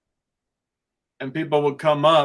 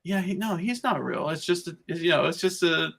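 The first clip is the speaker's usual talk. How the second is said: put-on girls debating; 3 semitones higher than usual. Girls deciding the scarecrow is fake, imitated in higher voices. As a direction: mimic overheard chatter, pitched up. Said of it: "I heard their conversation".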